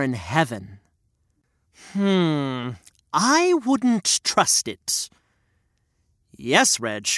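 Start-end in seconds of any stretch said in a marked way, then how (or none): 1.9-5.1 slow, sceptical character voice; Reg's puppet voice. Acted. Reg warns the storyteller, sceptical puppet voice.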